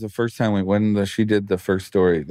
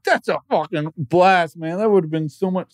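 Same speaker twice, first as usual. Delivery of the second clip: put-on drunk partygoer; 9 semitones higher than usual. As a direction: gush like an impressed party guy. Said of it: "people were like".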